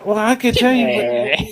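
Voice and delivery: ordinary talk, nothing marked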